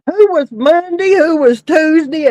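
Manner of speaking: ordinary talk, nothing marked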